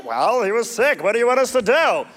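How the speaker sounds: lightly voiced defensive soldier, quick; whiny excuse. Soldiers excusing themselves to angry Saul, mildly whiny. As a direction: shrug it off, a touch pleading.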